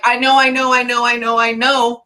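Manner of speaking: retold reply, run together over and over; insistent acknowledgement. Channels an exasperated, repeated reply.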